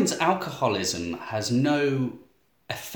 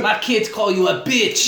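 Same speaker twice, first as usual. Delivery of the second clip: slow, loud, high mock-French; French accent; 8 semitones higher, 6 dB louder than usual. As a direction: drag it out in a hammed-up French accent. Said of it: "Is that your French accent?".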